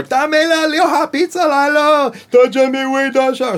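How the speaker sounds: very high, loud, slow mock Chinese ad voice; pizza ad voice. Shown as a demonstration. Voice actor mimicking his Pizza Hut ad, high and loud. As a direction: hype it like a pizza commercial. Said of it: "or something like that".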